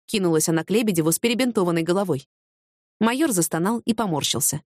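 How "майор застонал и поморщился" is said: ordinary talk, nothing marked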